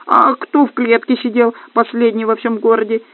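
affronted little gnome arguing back; offended. A narrator's reading. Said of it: "обиделся гном Юхан".

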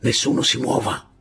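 plain reading voice

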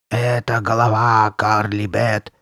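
slow, drawn-out monster voice; ominous voice. A narrator's reading. Masked girl repeating it to the boys, ominous. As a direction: stretch each word with menace. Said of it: "повторила она".

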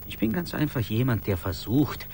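ordinary talk, nothing marked

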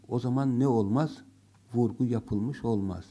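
ordinary talk, nothing marked

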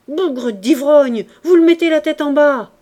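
a furious butler losing his dignity, shouting. A narrator's reading. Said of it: "la voix furieuse d'Hippolyte".

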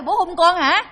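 high, very loud asking back; hammed-up incredulous double question; mock baffled question. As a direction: imitate someone loudly checking what they misheard. Retold confused asking back.

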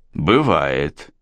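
low, firm single-word reply. A narrator's reading. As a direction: state it firmly, settling the matter. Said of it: "твёрдо заявил я".

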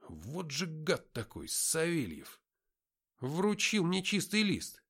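ordinary delivery

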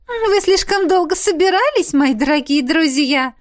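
high, loud, silly gushing; silly giggly. A narrator's reading. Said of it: "глупо хихикнула она". (глупо и кокетливо, высоким голосом)

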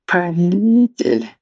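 high, piteous wailing cry; pitiful wail. Acted. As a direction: wail it like a groveling servant. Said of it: "жалко стенал Марквин".

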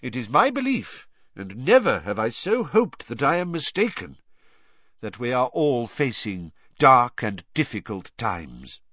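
solemn, measured speech to the hall; grave old headmaster. Acted. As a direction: speak like a grieving old wizard. Dumbledore's farewell feast speech.

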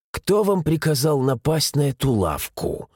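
ordinary delivery, nothing marked

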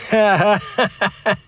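high, slow scornful laugh; mocking laugh. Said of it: "усмехнулся Юра".

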